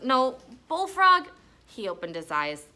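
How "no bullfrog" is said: sure guess. A narrator's reading. Stink correcting his guess.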